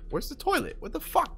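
very high exasperated question; frustrated squeak. Real, not acted. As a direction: squeak out in disbelief. Can't find the toilet.

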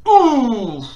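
loud falling "ooh"; high hoot at a hard hit; long startled ooh; startled whoop. Real, not acted. Reaction to a hit.

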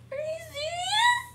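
high, whiny fake cry; hammed-up tearful protest; mock wailing. Complaining about dying in game.

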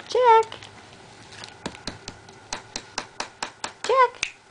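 high sing-song name call. Real, not acted. Calling the baby's name.

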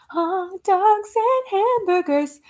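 high, slow comic character voice; hammed-up high sketch quote; Casey Tatum. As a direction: draw it out high, like a sketch oddball. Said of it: "I like the Casey Tatum".

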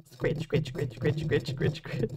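quiet breathy scratch, scratch; soft ASMR whisper. Shown as a demonstration. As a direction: murmur the words softly into the mic. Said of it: "I'm gonna lightly scratch on the mic".

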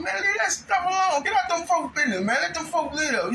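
high, shouted telling-off; high scolding yell, retold. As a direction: yell at her to stay out of it. His own retold scolding.

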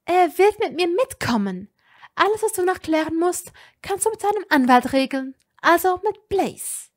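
every word an order; icy furious command. A narrator's reading. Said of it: "aus eiskalten Augen".

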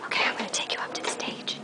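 very soft, breath-only whisper; hushed aside while nearing the stage; backstage whisper. Real, not acted. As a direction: whisper so as not to disturb the show. Backstage during a live show.

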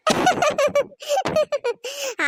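high burst of giggling; teasing laughter. Acted. Friend laughs at the botched love confession, teasing giggles. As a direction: crack up laughing at your friend. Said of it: "சிரிக்காத பூமாரி".

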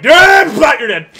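high, loud triumphant yell; retold battle cry. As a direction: yell it like scoring a kill. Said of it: "I was like".

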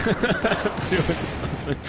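mocking echo. Mocks teammate's yell.

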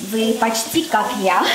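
ordinary delivery

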